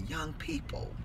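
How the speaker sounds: soft, disappointed lament; hushed, heartbroken plea. Retold or imitated. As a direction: say it to yourself, with long pauses. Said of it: "it just broke my heart".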